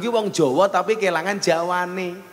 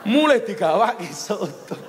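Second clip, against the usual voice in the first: usual speaking voice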